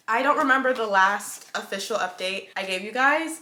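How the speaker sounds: usual speaking voice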